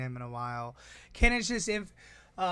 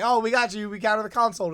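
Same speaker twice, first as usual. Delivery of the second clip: higher and louder, smug opponents; mock gloating; 6 semitones higher, 8 dB louder than usual. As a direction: ham up their premature triumph.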